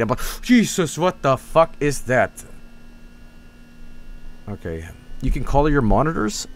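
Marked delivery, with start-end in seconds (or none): 0.4-2.4 high first word, then drawn-out swearing; mock alarm, slowly stretched out; retold startled reaction. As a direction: act out a mild shock, slowing down.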